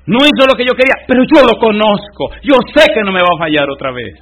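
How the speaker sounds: loud, driving pulpit voice with high pitch; preaching at full force. Acted. Preacher proclaiming God's words to the church, loud. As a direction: thunder the promise to the congregation.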